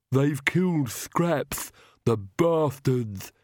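slow, odd, heavy servant's voice; Igor, slow and mournful; Igor's grieving voice. A narrator's reading. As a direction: drag each word out in grief. Igor mourns his dog.

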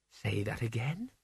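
soft, hushed question, rising at the end; quiet. A narrator's reading. Isabel asks Mort to repeat himself, quiet. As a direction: ask it quietly, well below normal level. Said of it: "she said quietly".

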